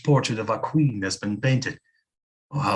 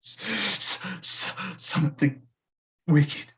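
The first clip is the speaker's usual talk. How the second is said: fearful stammer; 9 semitones higher, 4 dB quieter than usual. Acted. Stammering in fear.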